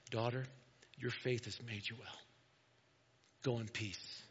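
soft, low voice imitating a gentle healer; tender reassurance. Jesus reassures the healed woman.

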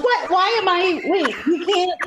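rising shock at the words she has to read; startled disbelief. Retold or imitated. Reading shocked script live.

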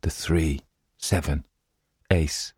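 soft, toneless muttering; a lunatic's quiet, droning repetition with gaps between words; monotonous murmuring. A narrator's reading. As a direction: murmur each card separately, all on one note. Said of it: "constantly murmuring in a monotonous tone".

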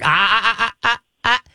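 rising sharp ah ah sounds; warning ah ah. Shown as a demonstration. Trainer showing how to interrupt barking. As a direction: cut the bark off with short ahs. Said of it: "if she's gonna start bark".

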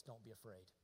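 very soft and low; hushed, low, calming voice; gentle reassurance. Acted. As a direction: comfort tenderly, almost under the breath. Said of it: "Unless you do it the second way".

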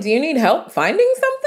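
retelling a sarcastic offer of help to airport security; mock-helpful sarcasm. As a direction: needle the guards with fake helpfulness. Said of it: "I'm like".

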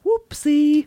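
high British whoopsie; a posh British oops; British accent. Retold or imitated. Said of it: "But in a British accent".

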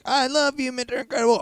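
high-pitched gushing fanboy voice; Syndrome. Acted. Host imitating the movie villain, high fanboy voice. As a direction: squeal it like an adoring kid villain. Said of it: "Yeah, he's like".

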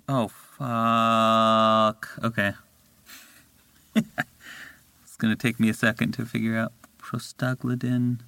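ordinary talk

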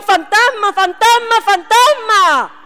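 acted cry of alarm, last word drawn out; panicked shouting. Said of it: "salían corriendo y gritaban".

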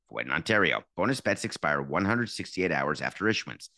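rapid legal disclaimer; low fast compliance read. Acted. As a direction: rattle off the fine print.

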